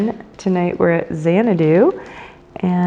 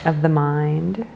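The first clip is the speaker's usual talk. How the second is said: guided meditation. Acted. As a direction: guide them into the breath, unhurried and measured.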